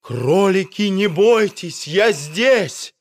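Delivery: doctor calling to frightened rabbits. A narrator's reading. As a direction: call through the flames so they hear. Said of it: "крикнул доктор".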